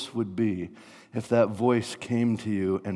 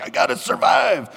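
high, loud panicked yell; hammed-up cry of desperation; desperate survival shout; 9 semitones higher, 8 dB louder than usual. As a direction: shout it as if trapped. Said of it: "you're like".

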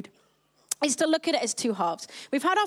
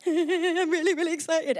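giggly, higher excited babble; giddy excitement; 3 semitones higher than usual. Retold or imitated. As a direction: bubble over with giggles like an overexcited fan. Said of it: "I get really excited".

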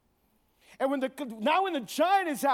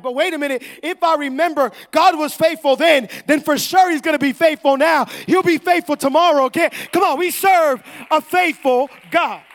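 loud, raised preacher's voice; full-force preaching; 4 semitones higher, 13 dB louder than usual. Real, not acted. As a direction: fire up the congregation.

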